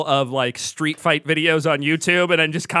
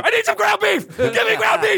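high-pitched mock-frantic shouting; frantic begging; 13 semitones higher than usual. Man pounding on neighbor's door for dinner help, frantic. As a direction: plead in a panicked squeal. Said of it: "frantically knocks on the next door neighbor".

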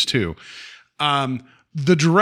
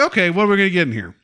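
quick, raised skeptical voice; skeptical quip; 5 semitones higher, faster than usual. Retold or imitated. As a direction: rattle it off with doubt. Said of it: "I'm like".

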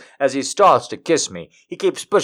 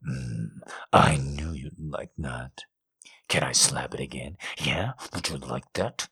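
low seductive lover; 11 semitones lower, 12 dB quieter than usual. Acted. Lover teases her after biting her lip, low seductive murmur.

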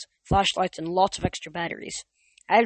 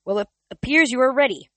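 higher, louder forced cheer; higher, louder forced cheerfulness; forced upbeat; 6 semitones higher, 7 dB louder than usual. A narrator's reading. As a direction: sound upbeat while hiding worry. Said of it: "He tried to sound upbeat".

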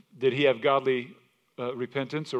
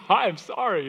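laughing apology; 7 semitones higher, 6 dB louder, slower than usual. Retold or imitated.

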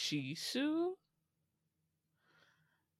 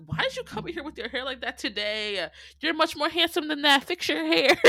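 mock bossy friend; 9 semitones higher, 6 dB louder than usual. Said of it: "She's like".

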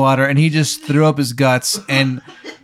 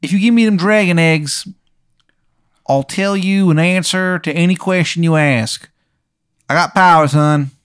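louder, raised bad Baltimore accent; bad Maryland accent; 5 semitones higher, 4 dB louder than usual. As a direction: lay the awful Maryland accent on thick. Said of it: "this guy's accent sounds like shit".

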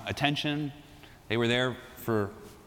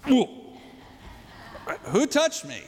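startled whoa; 10 semitones higher, slower than usual. Acted. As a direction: jump up in mock alarm. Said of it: "He's literally like".

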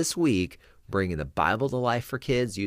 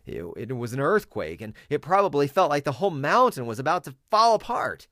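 higher and louder as the mountain shakes; rising earthquake drama; 8 semitones higher, 5 dB louder than usual. Shown as a demonstration. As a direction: build the rumble into alarm. Earthquake narration.

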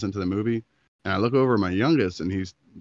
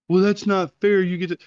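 higher, mock-indignant ex-wife; mocking whiny complaint; 12 semitones higher, 7 dB louder than usual. Ex-wife protesting on the phone, mock whiny voice. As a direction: parrot her complaint in a put-on whine.

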